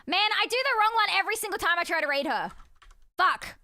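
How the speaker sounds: loud, annoyed viewer complaint; frustrated viewer. Retold or imitated. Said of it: "they're like".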